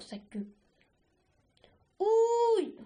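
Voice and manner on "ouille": high-pitched howl of pain; pained yelp. A narrator's reading. Argile after his tail is stepped on, pained yelp. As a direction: yelp as if hurt suddenly. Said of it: "hurla-t-il".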